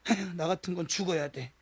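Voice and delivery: defeated sigh. Retold or imitated. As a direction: sigh it out like someone crushed. Slumped shoulders, head bowed, despairing.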